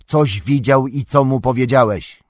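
loud, firm interrogation; stern. A narrator's reading. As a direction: demand an answer severely. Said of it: "rzekł Gandalf surowo".

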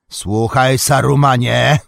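loud, high, mighty call; powerful commanding shout. A narrator's reading. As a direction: command him to listen. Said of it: "krzyknął głosem potężnym i władczym". (potężny, władczy okrzyk)

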